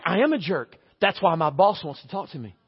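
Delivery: low, subdued self-blame; dejected admission. Acted. Stressed husband admitting to himself he's a jerk, dejected.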